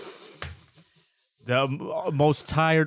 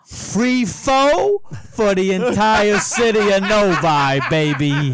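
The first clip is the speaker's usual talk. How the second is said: mock announcer; 5 semitones higher, 5 dB louder, slower than usual. Announcing free pho promo.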